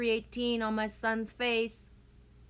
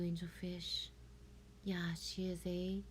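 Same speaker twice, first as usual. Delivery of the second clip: soft unvoiced whisper; 3 semitones lower, 10 dB quieter than usual. Real, not acted. Whispering an aside to someone off camera. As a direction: whisper it so nobody hears.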